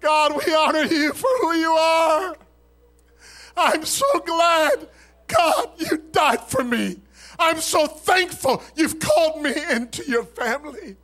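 high, loud cry of praise to God; loud, impassioned praying, pitched high; fervent prayer. Real, not acted. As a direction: cry out thanks to God at full voice. Prays aloud at full force.

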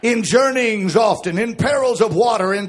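half-sung sermon cadence over music; preaching. Acted. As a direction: build it back up for the church. Sermon builds again.

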